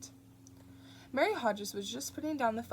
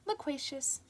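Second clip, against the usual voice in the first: hollow voice; 6 semitones higher than usual. Acted. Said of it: "in a hollow voice".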